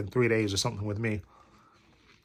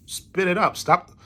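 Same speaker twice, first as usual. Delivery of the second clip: higher-pitched exasperated urging; mock-urge him to stop, pitch raised; retold urging; 6 semitones higher, slower than usual. Friends telling him to clear his phlegm, urging.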